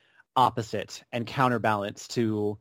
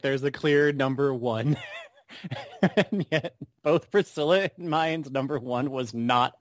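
ordinary talk

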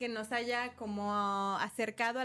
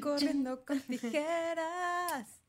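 slow, drawn-out and higher announcer voice; show-host announcement; 5 semitones higher, much slower than usual. Pretend. Host announcing the podcast name to the new guest, slow and high. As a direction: stretch the show name out like a grand MC.

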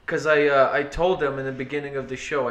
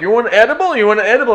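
pushy dealer voice; 7 semitones higher, 9 dB louder, faster than usual. Retold or imitated. Imitating dealers offering edibles.

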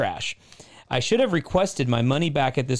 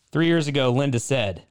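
normal talking voice

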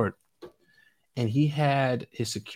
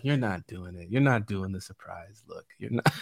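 quiet, let-down murmur, a shade lower; 5 semitones lower, 5 dB quieter than usual. Retold or imitated. Prince tells [name] he is failing, quietly disappointed. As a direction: sigh out your disappointment softly. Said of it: "he looked so disappointed".

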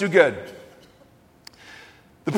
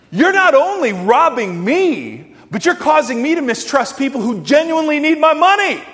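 raised voice, fired up; 8 semitones higher, 5 dB louder than usual. Retold or imitated. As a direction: re-enact an indignant telling-off, voice climbing. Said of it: "And my voice raises".

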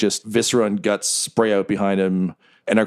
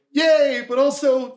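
high cheering yay from the crowd; slower than usual. Acted. Townsfolk cheering at the fall. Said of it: "We hear everyone go".

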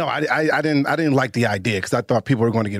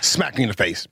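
ordinary talk, nothing marked

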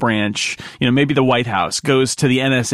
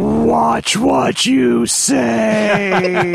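very slow, higher, drawn-out shout; dragon voice; 10 semitones higher, much slower than usual. Pretend. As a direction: boom it like a dragon.

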